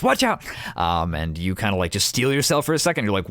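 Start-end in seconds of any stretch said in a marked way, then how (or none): 0.0-0.4 high loud shout; same urgent warning from the brush; yelled warning. Acted. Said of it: "he just goes".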